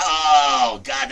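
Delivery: high, loud, slow excited shout; excited exclaim. Real, not acted. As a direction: burst out with delight.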